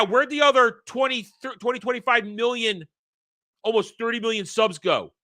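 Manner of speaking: ordinary delivery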